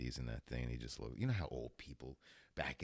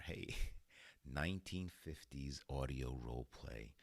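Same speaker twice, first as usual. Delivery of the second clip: usual speaking voice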